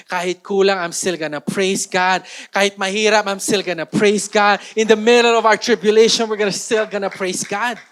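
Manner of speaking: loud, building declaration of praise; preaching at full force. Real, not acted. Preacher rallying the church, loud building proclamation.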